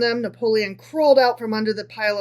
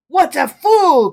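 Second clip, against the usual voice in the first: loud, slow, higher cry of scorn; disdainful cry; 7 semitones higher, 10 dB louder, slower than usual. Acted. Napoleon scorns the tailor's wish, crying out disdainfully. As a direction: cry out in contempt. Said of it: "cried Napoleon disdainfully".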